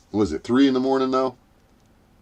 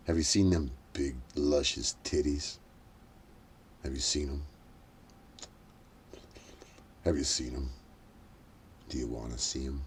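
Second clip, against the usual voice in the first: mock sultry tease; 7 semitones lower, 12 dB quieter than usual. Teasing chat about pictures.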